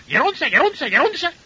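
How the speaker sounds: high-pitched parrot imitation, repeated calls; parrot calling out. Parrot calling for the nun behind the elder, high mimicking cries. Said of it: "να φωνάζει ο παπαγάλος".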